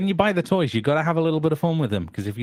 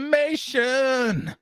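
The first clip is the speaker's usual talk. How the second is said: excited announcer shout; 13 semitones higher, slower than usual. Acted. Screen retracts, hype.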